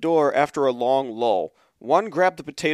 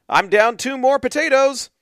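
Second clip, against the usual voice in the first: bright, raised voice on the potato count; excited reading; 10 semitones higher, 6 dB louder than usual. Retold or imitated. Host reading a tweet to her cohost, mock alarm.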